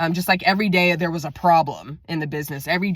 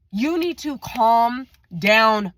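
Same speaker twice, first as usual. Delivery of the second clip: very slow, higher, emphatic; retold stern order; 6 semitones higher, 3 dB louder, much slower than usual. Telling ranting coworker to calm down, slow emphatic order. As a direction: spell it out at half speed, pitched up.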